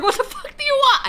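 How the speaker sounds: mock annoyed yell. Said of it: "I was like".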